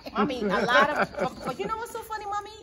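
laughing through the words, pitch jumping high. Real, not acted. To Mommy about the kids at the well, laughing. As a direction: giggle it out while telling the story.

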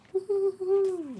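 high, slow falsetto cheer; excited whoop. Real, not acted. Vlogger cheering at her new surfing magazine, high whoop. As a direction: whoop with glee over the magazine.

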